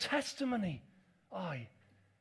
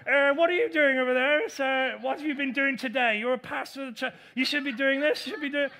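mock scolding critic; 4 semitones higher, 8 dB louder than usual.

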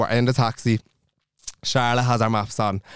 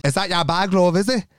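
higher-pitched mock challenge, rising at the end; confrontational; 6 semitones higher than usual. Charlotte to woman over the bag, mock confrontational. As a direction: imitate a woman picking a fight.

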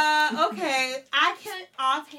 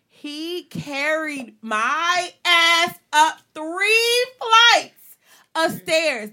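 emphatic telling; 5 semitones higher, 6 dB louder, much slower than usual. Real, not acted. Emphatic climax of story.

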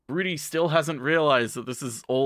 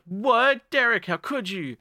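high-pitched mock outrage; hammed-up, higher-pitched dismay; shocked betrayal; 10 semitones higher, slower than usual. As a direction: cry out in disbelief at a traitor. Acted betrayal, shocked second take.

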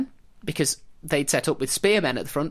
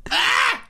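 exasperated cry; 6 dB louder than usual. Retold or imitated. Exasperated cry ends rant.

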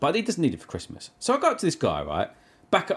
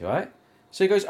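usual speaking voice

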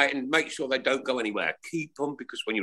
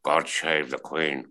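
put-on lower, slow slushy Scottish accent; Sean Connery; 9 semitones lower, slower than usual. Telling the panel he shaved the Queen, slow Scottish Connery. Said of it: "I did my Sean Connery impression".

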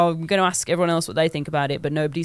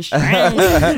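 posh excited Stray; 2 semitones lower, 6 dB louder than usual. Real, not acted. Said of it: "I can't say it without going".

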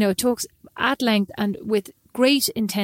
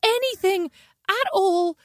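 complaining friend; 10 semitones higher than usual. Retold or imitated. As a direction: drag it out high like a teasing friend. Julie complains, retold.

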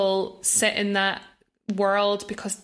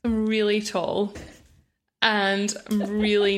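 normal talking voice

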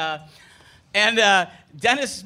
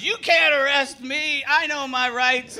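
slow, higher protest; indignant drunk groom; 7 semitones higher, slower than usual. Retold or imitated. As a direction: whine that he knows his rights. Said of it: "the guy goes".

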